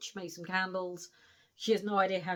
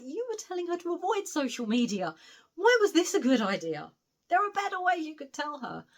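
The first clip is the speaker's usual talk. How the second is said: exasperated; 8 semitones higher, 5 dB louder than usual. Real, not acted. Reviewer exasperated at Frankie.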